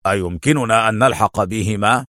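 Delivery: ordinary delivery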